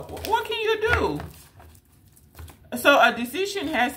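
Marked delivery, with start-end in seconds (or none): 0.0-1.2 much higher, small mock squeak; high, helpless mock yell of a tiny person; tiny squeaky voice. Shown as a demonstration. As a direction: squeak it like someone tiny shouting at the universe. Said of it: "All you can do is yell".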